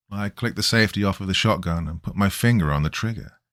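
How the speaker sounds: ordinary delivery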